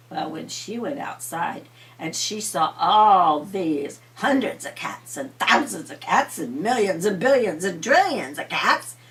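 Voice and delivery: ordinary delivery, nothing marked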